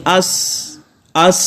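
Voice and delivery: اس with a soft, lingering s; اس said with the hiss dying out; breathy s. Shown as a demonstration. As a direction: air keeps going on اس. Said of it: "آواز جاری رہے گی".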